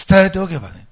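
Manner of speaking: loud, insistent repeat; emphatic. Shown as a demonstration. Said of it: "もう一遍言いますよ。".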